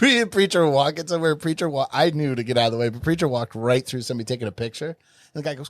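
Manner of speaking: ordinary delivery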